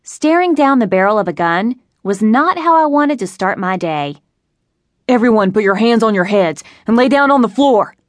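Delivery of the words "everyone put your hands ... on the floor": a raised, barked order to the room; robber's shout. A narrator's reading. Robber ordering bank customers down, loud shout. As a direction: command the hostages through a muffled ski mask. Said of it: "the robber shouted".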